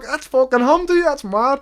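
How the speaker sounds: loud, high re-enactment of recognising him; excited. Retold or imitated. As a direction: blurt it out in disbelief. Retelling own excited reaction.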